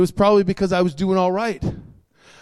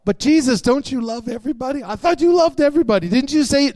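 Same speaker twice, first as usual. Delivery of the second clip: higher, quick run of puzzled questions; protesting objector; 6 semitones higher, 3 dB louder than usual. Acted. Preacher playing a believer questioning Jesus, quick mock protest. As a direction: rattle off the objection, pitched up.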